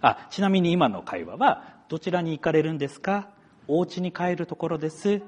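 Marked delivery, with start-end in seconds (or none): 1.9-5.2 polite conversation demo. Shown as a demonstration. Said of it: "という感じの会話".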